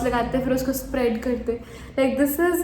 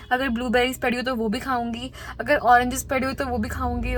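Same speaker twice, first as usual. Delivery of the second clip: ordinary delivery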